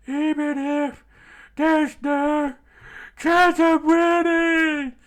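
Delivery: high, loud, drawn-out scream. Acted. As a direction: scream through the pain. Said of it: "he screamed out".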